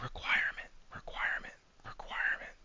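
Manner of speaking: eerie repeated whisper in the ear; spooky whispered chant to haunt a chatter. Pretend. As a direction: whisper it like a ghost at bedtime. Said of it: "he's just gonna hear".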